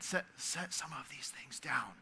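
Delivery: soft, breathy whisper; hushed whisper. Retold or imitated. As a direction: whisper it like a release. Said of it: "Jesus says to Martha".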